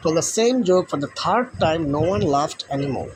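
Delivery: slow, each word spaced out; slow story reading. Shown as a demonstration. Teacher reads the story line to the class. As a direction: read the lines slowly for pupils. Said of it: "W বড় হাতের হবে".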